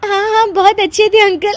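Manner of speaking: high, giggling words held back badly; giggling out the agreement; laughing through words. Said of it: "हंस मत".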